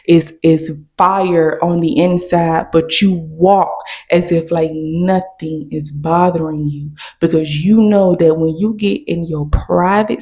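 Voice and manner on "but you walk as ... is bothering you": usual speaking voice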